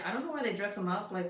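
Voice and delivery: ordinary talk, nothing marked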